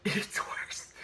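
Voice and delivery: hushed, breathless aside; whispered retort. Retold or imitated. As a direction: whisper it like a sulky correction. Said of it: "Star was like".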